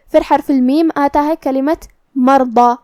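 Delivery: ordinary delivery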